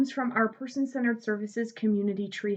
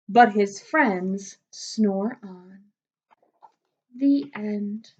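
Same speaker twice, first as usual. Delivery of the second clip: put-on lively read-aloud for kids, slowing on the refrain; storybook reading; 2 semitones higher, 6 dB louder, slower than usual. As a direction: read it like bedtime story time for little ones. Reads picture book to children.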